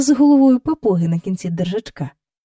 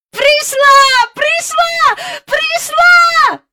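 дуже високо й гучно кричить; кричи на всі груди від радості; крик на всі груди; 8 dB louder, much slower than usual. A narrator's reading. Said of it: "на всі груди кричав Майкл". (Майкл щосили радісно кричить)

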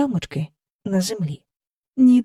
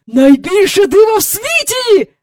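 high, loud cry of wonder; thrilled, pitched-up shout; excited exclamation; 13 semitones higher, 8 dB louder than usual. A narrator's reading. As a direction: proclaim a marvel at full voice. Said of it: "вигукнув він".

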